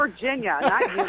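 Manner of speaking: laughing. Real, not acted. Joking back, laughing.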